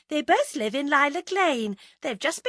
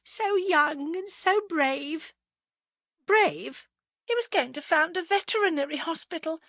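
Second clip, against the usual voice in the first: tearful adoration; 10 semitones higher, 3 dB louder than usual. A narrator's reading. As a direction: gush with grief, pitched well up. Dabs eyes, mourns dead lover.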